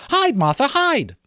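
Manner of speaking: put-on very high, squealing children's cry; excited children. The two young Cratchits greet their sister, high and thrilled. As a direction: shout it like two thrilled little kids.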